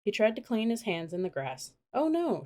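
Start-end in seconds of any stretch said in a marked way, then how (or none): 1.9-2.4 high, sharp cry of alarm; alarmed shout. A narrator's reading. Annie seeing the wildebeest heading for the mud, alarmed shout. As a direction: yelp it out in sudden alarm. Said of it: "shouted Annie".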